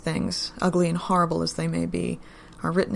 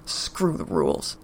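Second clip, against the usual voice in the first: low, slow snarl; growled; 13 semitones lower, slower than usual. Acted. Daryl defies Joe and Len with a low growl. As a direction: growl it at them through the blood. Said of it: "Daryl growled".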